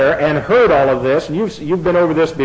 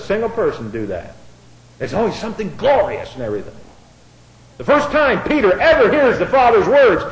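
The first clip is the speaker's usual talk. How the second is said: ordinary delivery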